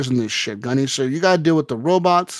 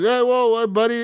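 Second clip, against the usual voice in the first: high, hurried whoa buddy, pitched well up; startled; 9 semitones higher than usual. Retold or imitated. Player meeting a stranger, high startled calming. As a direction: back off fast, hands up.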